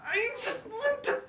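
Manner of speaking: high, slow strangled frustration; mock exasperation. Teacher pushed too far by a student, high slow exasperation. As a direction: groan it out in fake fury. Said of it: "makes me just wanna go".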